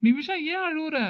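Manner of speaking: high sing-song mock concern; mock concerned brother. Brother teasing his crying sister, in high mock concern. Brother overdoes sympathy mockingly.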